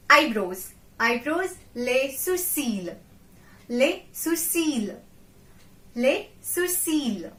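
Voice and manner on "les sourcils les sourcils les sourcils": les sourcils stretched slowly, each syllable clear; slow careful pronunciation. Shown as a demonstration. Teacher slowly modelling a hard word for learners. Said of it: "A quite hard word".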